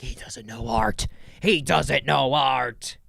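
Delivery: put-on snooty protest, slowing on the repeat; hammed-up indignation, words dragged out; mocking outraged critics. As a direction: parody people up in arms.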